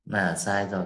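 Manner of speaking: ordinary delivery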